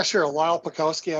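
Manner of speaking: ordinary delivery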